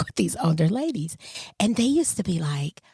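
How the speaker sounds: ordinary delivery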